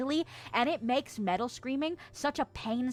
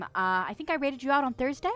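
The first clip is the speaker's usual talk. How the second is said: usual speaking voice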